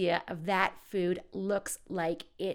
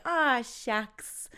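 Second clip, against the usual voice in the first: bashful, a little higher and louder; cute aw shucks; 4 semitones higher, 5 dB louder than usual. Shown as a demonstration. Accepting a compliment in a cute bashful way. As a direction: play it cute and flattered. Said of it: "in a cuter way".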